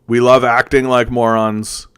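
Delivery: ordinary delivery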